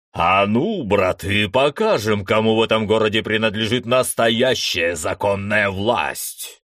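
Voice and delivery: loud battle roar to comrades; bear-like roar. Acted. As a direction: bellow like a bear. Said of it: "заревел, словно ... настоящий медведь".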